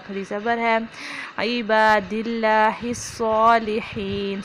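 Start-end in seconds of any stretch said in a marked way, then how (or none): 1.4-4.4 عباد اللہ الصالحین slow, heavy sad; correct recitation. Shown as a demonstration. Teacher demonstrating the line. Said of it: "صاد کو پر پڑھیں گے".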